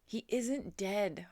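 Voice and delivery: mild farmer's holler. A narrator's reading. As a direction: holler it across the ring. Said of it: "hollered Mister Zuckerman".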